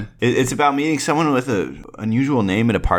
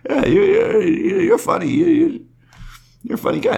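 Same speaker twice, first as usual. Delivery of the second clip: high voice. Pretend. Regan character praising host.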